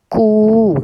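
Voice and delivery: कु drawn out as "kooo"; long vowel. Shown as a demonstration. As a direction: hold the oo in कु. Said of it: "देर तक बोल रहे हैं".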